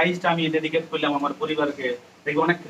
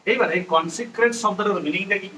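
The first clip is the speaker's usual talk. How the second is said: normal talking voice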